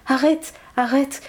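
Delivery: high urgent cry of arrête; crying out to stop her. Acted. Friend rushing to stop Ananga Manjari: high urgent cry. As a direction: call out sharply, pitched high, to stop her. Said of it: "Elle accourut en criant".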